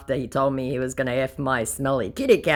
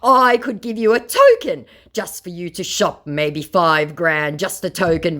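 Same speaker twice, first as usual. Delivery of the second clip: mock scammer; 4 semitones higher, 6 dB louder than usual. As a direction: dangle the money like a sly scammer. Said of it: "my scammer said".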